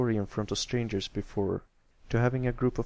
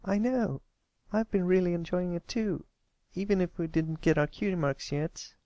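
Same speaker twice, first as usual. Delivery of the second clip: content filly; 8 semitones higher than usual. Acted. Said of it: "Applebloom sighed".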